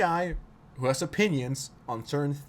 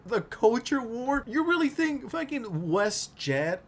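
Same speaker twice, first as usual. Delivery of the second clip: incredulous; 8 semitones higher, slower than usual. Real, not acted. Incredulous rant at Reddit critics.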